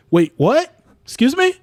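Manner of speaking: sudden high mock surprise; fake surprise. Stalling the meeting, a high faked surprise. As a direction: pretend you just noticed something.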